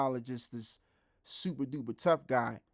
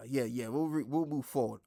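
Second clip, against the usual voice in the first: ordinary delivery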